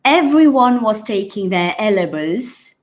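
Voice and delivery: A LEVELS run together as "ELL-uh-bulz"; imitated mispronunciation. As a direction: mimic the learner slurring A LEVELS into "ELLerbulls". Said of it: "he said something like this".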